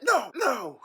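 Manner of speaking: high, loud firm no; commanding dog owner. Retold or imitated. Dog owner warning the cat away, high firm no. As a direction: warn off the animal sharply. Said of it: "I was like".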